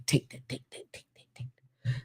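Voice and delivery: whispered, mocking chant under the breath. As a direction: breathe the words out in a sneering whisper.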